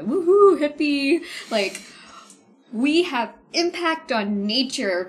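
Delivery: high, loud woohoo hippie tone; mock hippie voice. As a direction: gush like a dreamy hippie. Mocking hippie view.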